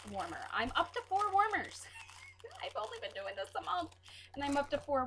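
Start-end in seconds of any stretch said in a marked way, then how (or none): 1.9-2.6 a short airy squeal of a laugh; high breathy giggle. Real, not acted. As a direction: giggle at yourself, unvoiced. Laughing at her own habit.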